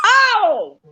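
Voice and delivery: high, drawn-out cry of disgust; real horrified shock. Real, not acted. Listener hearing he bit a stink bug, real horrified outburst.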